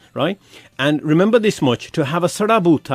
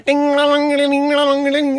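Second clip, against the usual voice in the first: very high, monotone looping jingle; imitated van chime; 11 semitones higher, slower than usual. Ice cream man waits outside the host's house, imitated chime. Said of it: "I'm sitting there going".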